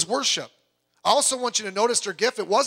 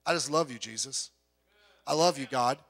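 tender prayer; 6 semitones lower, 3 dB quieter than usual. Acted. Sample loving prayer to God.

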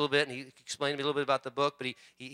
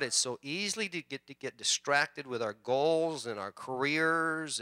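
prayer; 4 semitones lower than usual. Acted. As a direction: pray humbly and gently to God. Pastor leads a prayer.

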